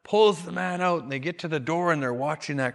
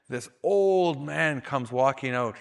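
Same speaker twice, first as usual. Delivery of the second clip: ordinary delivery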